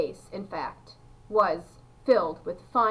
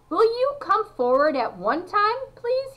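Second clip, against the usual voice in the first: high, raised call to the crowd; 11 semitones higher, 5 dB louder than usual. A narrator's reading. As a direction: summon them politely in a high, loud voice. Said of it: "His voice was high".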